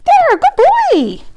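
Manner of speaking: high, loud happy praise; excited puppy praise. Real, not acted. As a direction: gush over the dog.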